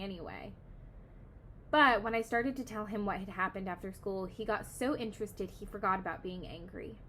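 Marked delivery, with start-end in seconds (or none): none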